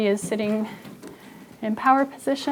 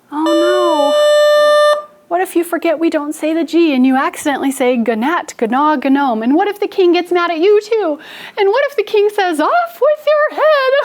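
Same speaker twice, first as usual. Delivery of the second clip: scared ghost; 8 semitones higher, 4 dB louder than usual. Acted. Teacher playing the scared ghost fearing the king, mounting panic. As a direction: panic building from fast high fretting to a shrieked climax. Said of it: "looks kind of scared".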